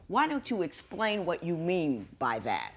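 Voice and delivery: ordinary talk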